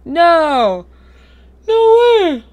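high, loud shout of no way; loud disbelief. Real, not acted. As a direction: yell it in shock. Reacts to abrupt game ending.

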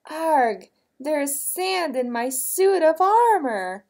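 loud, high wail without real tears; story-time distress, loud and high; mock sobbing knight. A narrator's reading. Knight with sand in his armor, mock sobbing. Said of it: "sobbed the bravest knight in the kingdom".

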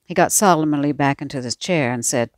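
plain reading voice

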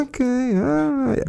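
high, slow mock "Okay, all right"; drawn-out teasing Denzel line; mimicking the defensive catchphrase. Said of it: "is to throw that".

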